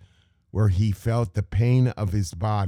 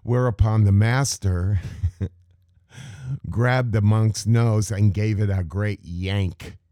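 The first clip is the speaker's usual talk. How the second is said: ordinary delivery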